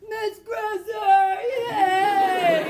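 high, loud cheer out of a car window; students cheering. Retold or imitated. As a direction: yell her name like excited teens. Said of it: "and going".

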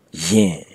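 "yin" repeated clearly, low; Burmese pronunciation. Shown as a demonstration. Teacher repeats "yin" for learners, careful demo. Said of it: "Let me pronounce it for you again".

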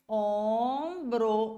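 OMBRO very slow, a long nasal Õ, then BRO. Shown as a demonstration. Teacher sounds out the phonetic spelling, very slow nasal vowel. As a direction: hold the first vowel of "ombro" through the nose. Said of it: "o O com o tiozinho".

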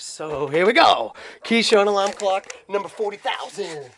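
loud, drawn-out build-up with swooping pitch; mock announcement. Vlogger presenting the dogs' morning alarm clock, mock-grand announcement. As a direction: announce it big, high and loud.

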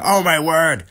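mock excited fight commentary; imitated commentator outburst; Bisping commentary. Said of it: "Bisping's gonna just jump in and go".

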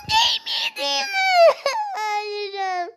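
high cry-shout breaking into laughing sobs; half-crying shriek. Real, not acted. Gamer wailing in frustration after losing a life. Raging after dying in game.

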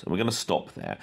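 usual speaking voice

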